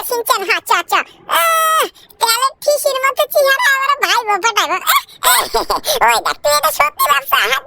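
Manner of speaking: put-on very high, bratty child voice calling out; squeaky little brat yelling for his friend; cheeky cartoon kid. Kid calling for Doraemon, high squeaky voice.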